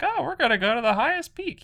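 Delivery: high, quick mimicry of an eager child; excited boy. Retelling Atreus telling everybody the plan, excited boy voice. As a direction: pitch it way up like a boy blurting plans.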